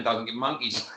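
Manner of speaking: laughing. Real, not acted. Laughing retelling mate's call.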